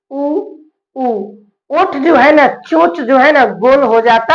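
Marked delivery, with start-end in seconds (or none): 0.1-1.1 उ sounded twice with rounded lips; vowel demo. Shown as a demonstration. Teacher showing students the lip vowel उ, deliberate. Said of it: "जरा बोल के देखिए".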